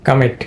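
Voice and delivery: "কামিট" with first vowel reduced to "কা"; second-syllable stress. Shown as a demonstration. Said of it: "দ্বিতীয় সিলেবলে stress পড়েছে".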